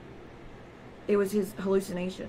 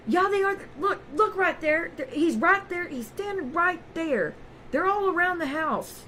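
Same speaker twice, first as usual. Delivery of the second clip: much higher, slow, pleading that the cops are there; insistent paranoid husband; 8 semitones higher than usual. Retold or imitated. Husband insisting to her that men surround the house, high and slow. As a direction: drag it out, certain and scared. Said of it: "I like".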